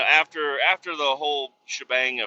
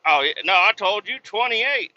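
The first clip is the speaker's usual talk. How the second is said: a raised, lightly mocking voice; mocking the dock worker; 8 semitones higher, 4 dB louder than usual. Imitating the dock worker brushing off the driver. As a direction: toss it off like a dismissive worker. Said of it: "they were like".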